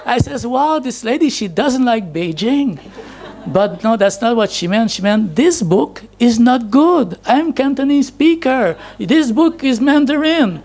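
ordinary talk, nothing marked

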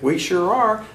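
retold yell. Answering a stranger on the other escalator, retold yell. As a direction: imitate calling out to someone passing. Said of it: "And I yelled back".

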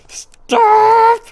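shrill, high panicked shriek; terrified scream. Real, not acted. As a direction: scream as the monster lunges. Jump scare hits.

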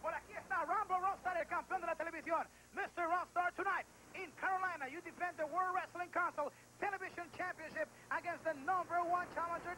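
ordinary talk, nothing marked

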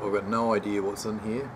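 ordinary talk

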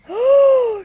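loud, high gasp of fright; startled gasp. Real, not acted. Player spotting an enemy in horror game, startled gasp. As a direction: gasp sharply at a sudden scare.

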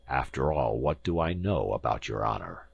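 low, doubting question; a touch of insolent distrust; sceptical. A narrator's reading. Professor questions the visitor's honour, low and sceptical.

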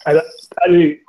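ordinary talk

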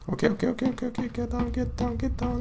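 high, soft nervous hush; urgently hushing yourself while hiding; scared hushed voice. Real, not acted. Hiding from monster.

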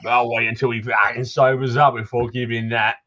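put-on townsman in a Cockney accent, gruff and chatty; grumbling Cockney local greeting a stranger; Cockney NPC. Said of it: "try and sound a Cockney".

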